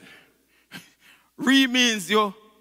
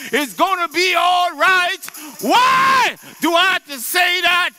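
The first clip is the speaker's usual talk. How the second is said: loud, high shouted sermon; preaching at full force; 9 semitones higher, 4 dB louder, slower than usual. Acted. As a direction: shout it out to the congregation with conviction.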